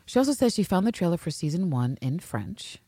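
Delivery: ordinary delivery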